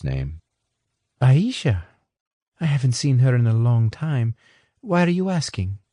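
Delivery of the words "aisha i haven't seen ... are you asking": lightly voiced English woman, a touch higher; a hint of a polite English woman; Anna. A narrator's reading. Anna explaining to Johnson, lightly voiced.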